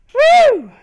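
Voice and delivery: loud, very high victory whoop; cheering whoop of relief; excited whoop. Real, not acted. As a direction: let out a triumphant whoop. Cheering again.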